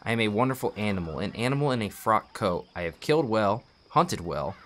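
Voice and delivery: narrating a tragic Russian novel; story narration. Acted. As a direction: read the novel aloud like an audiobook. Reads a book aloud.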